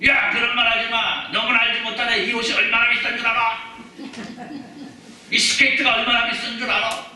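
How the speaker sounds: loud, high acted protest; hammed-up outraged shouting; indignant retort. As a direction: yell back stubbornly at the rescuers. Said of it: "이렇게 얘기를 합니다".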